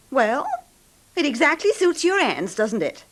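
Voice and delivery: angry accusation. Acted. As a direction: spit it at him with scorn. Wife accuses husband in quarrel.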